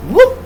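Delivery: high, loud "whoop"; a quick yelp for a slip; short high-pitched whoop of surprise; sudden slip whoop. Acted. Mimics one sudden slip overboard.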